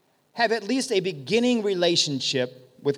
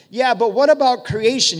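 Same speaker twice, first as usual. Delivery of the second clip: louder, higher skeptical challenge; challenging objector; 5 semitones higher, 8 dB louder than usual. Acted. Objector questions the healed leper, challenging. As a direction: press him with a loud objection.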